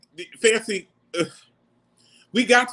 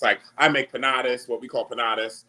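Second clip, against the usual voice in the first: ordinary talk, nothing marked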